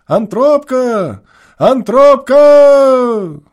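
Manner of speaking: put-on a boy calling his brother far off in the dusk, drawn-out desperate shout. A narrator's reading. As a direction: stretch the last syllable, tearful and stubborn. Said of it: "кричал он с упорным и слезливым отчаянием".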